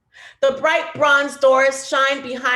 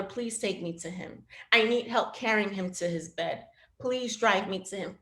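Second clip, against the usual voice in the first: soft, quiet begging for a ride; soft pleading; 7 dB quieter than usual. Retold or imitated. Mother begging father to drive her, softly pleading. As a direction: plead gently, almost under the breath.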